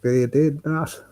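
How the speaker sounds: put-on slow, high Tom Barry in a thick Cork accent; bad Cork accent. Mimicking Tom Barry's interview in a bad Cork accent. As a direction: draw it out in a self-mocking Cork accent. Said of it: "It's my worst Cork accent".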